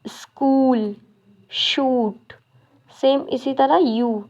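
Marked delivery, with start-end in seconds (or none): 0.1-2.3 स्कूल and शूट with the ऊ drawn out; long oo words. Shown as a demonstration. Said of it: "इसका मतलब बड़ा ऊ". (स्कूल, शूट में ऊ लंबा खींचें)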